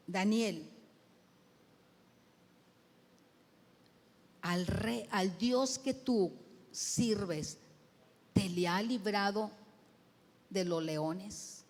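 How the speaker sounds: subdued, halting call with long pauses; mildly acted heartbroken question; broken-voiced king. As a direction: ask slowly, as if dreading no answer. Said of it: "quizás quebrada la voz".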